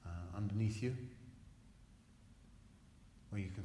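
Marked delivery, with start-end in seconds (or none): none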